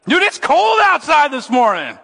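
high, loud and drawn out; big emphatic outburst on the cold; excited exclamation. Real, not acted. Pastor to churchgoers on a freezing morning, loudly exclaiming.

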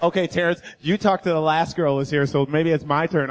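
a hint of a laid-back friend; low Peter voice.

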